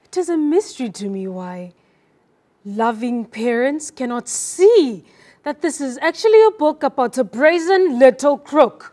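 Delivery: loud, exclaimed SEE with a long ee, then punch BRAZEN, LITTLE, CROOK; frustrated stresses. Shown as a demonstration. Student performs a mystified complaint for her coach, frustrated stress on SEE. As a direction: build to loud frustration, hesitating before LOVING PARENTS. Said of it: "it shows you the frustration".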